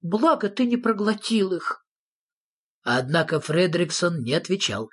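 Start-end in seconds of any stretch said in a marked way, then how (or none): 0.0-1.9 high-pitched nephew's exclamation; exclaiming. A narrator's reading. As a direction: exclaim in a small, high character voice. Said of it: "воскликнул его племянник".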